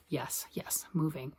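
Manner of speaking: playful stage whisper of yes, moving; mock whisper. Vlogger whispering to viewers about moving, a playful whisper. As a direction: whisper it like a secret.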